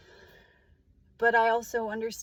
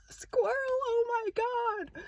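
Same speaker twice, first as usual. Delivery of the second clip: high, slow, thrilled squeal; excited squeal; 14 semitones higher, slower than usual. Retold or imitated. Spotting a squirrel on the morning walk, high thrilled squeal. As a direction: squeal it out like you just spotted something adorable.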